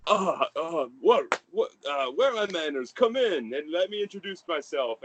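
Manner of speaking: high, merry fatherly gush; jolly father. Acted. The merry father welcoming his son home, high and gushing. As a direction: beam over the boy like a proud dad.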